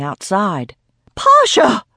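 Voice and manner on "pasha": high, loud gasped cry of the cat's name; shocked cry for her cat; alarmed gasp. A narrator's reading. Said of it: "she gasped".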